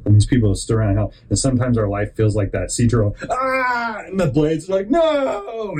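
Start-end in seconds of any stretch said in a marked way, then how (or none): 3.3-4.0 high mock scream of panic. Speaker acting out life feeling like the turtle, high mock scream. 4.1-5.7 high shouted no; mock shout. Storyteller acting out the turtle's protest, high mock shout.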